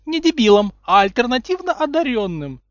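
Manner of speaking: high, lecturing know-it-all voice; prim correction. A narrator's reading. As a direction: correct him like a strict teacher. Hermione correcting primly. (назидательно, высоким голосом)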